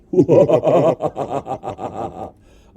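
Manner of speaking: loud evil cackle with reverb; unhinged villain laugh. Shown as a demonstration. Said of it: "that's the evil voice".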